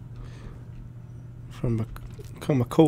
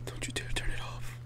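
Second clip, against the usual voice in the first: quick, soft voiceless whisper; 13 dB quieter, faster than usual. Real, not acted. Player warns game not to kill light, whispering. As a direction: whisper the warning hurriedly.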